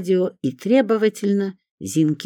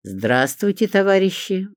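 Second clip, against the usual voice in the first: formal public greeting, rising higher; official, loud; 7 semitones higher than usual. A narrator's reading. Narrator stepping into the squabble, officially formal. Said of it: "официальным голосом громко сказала я".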